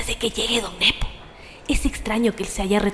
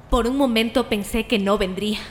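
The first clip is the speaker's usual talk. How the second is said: ordinary talk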